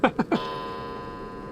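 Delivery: high, soft breathy giggle; quiet, high laughing fit; laughter. Real, not acted. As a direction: laugh at your own slip. Laughs at own odd sound.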